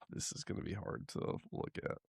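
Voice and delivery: hushed, almost unvoiced warning; soft whispered tease before showing the photo; whispered dread. Real, not acted. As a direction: whisper it like you can't bear to look.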